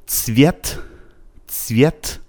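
"цвет" starting on a tight ts, no vowel before v; t-s cluster. Shown as a demonstration. Teacher recalling an earlier sound for learners, joined ts. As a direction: run t and s together at the start of "цвет".

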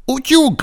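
high-pitched "اوتیوگ" with a softened ty; cutesy soft pronunciation. Shown as a demonstration. Teacher modelling a word needing a spoiled tongue, cutesy. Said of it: "باید لوس کنین زبونتون رو".

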